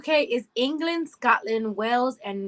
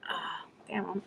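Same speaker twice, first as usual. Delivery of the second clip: whisper; 4 semitones lower, 12 dB quieter than usual. Real, not acted.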